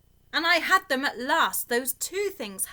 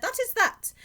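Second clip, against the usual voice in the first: satisfied cat; 12 semitones higher, 4 dB louder than usual. A narrator's reading. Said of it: "Then he said".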